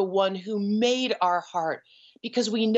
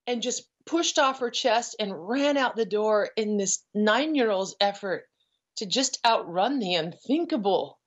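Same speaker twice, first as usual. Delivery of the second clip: ordinary talk, nothing marked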